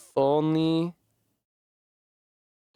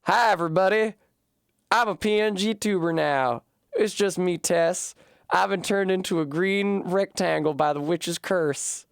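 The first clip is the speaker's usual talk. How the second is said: cheery mock greeting; 6 semitones higher than usual. Streamer greeting viewers as a PNG tuber, cheery and high. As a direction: play the cursed green rectangle, cheery.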